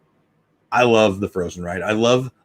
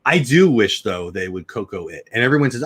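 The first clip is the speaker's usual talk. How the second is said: usual speaking voice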